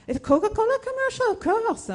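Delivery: high, fast, emphatic lightly French producer; exclaiming producer. Retold or imitated. French producer insisting it's Coca-Cola, high and emphatic. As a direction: toss it off as obvious, high and quick.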